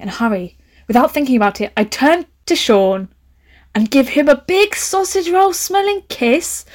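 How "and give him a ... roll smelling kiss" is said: rising shock. Real, not acted. Shocked reading of kiss.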